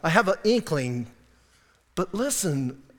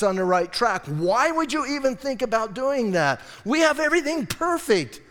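indignant scolding; 3 semitones higher than usual. Acted. Adam confronting his wife over what she did, indignant.

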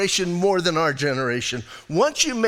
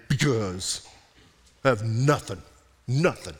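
much lower, sulky and softer; pouting Abraham; 8 semitones lower, 3 dB quieter than usual. Acted. Abraham complaining to God he has nothing, low sulky whine. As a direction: sulk it out like a child. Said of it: "And he's kinda pouting".

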